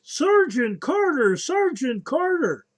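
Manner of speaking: put-on hollering gee-whiz country boy; Gomer Pyle. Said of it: "as Gomer Pyle would say".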